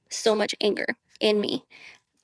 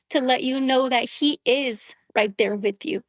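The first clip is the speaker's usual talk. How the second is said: ordinary talk, nothing marked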